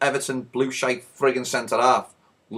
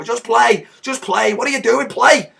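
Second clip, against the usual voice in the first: high, urgent repeated yelling; impatient keeper hollering at teammates; Alisson; 9 semitones higher, 5 dB louder than usual. Acted. Goalie breaking up the squabble, exasperated yell. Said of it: "the Liverpool goalie runs over".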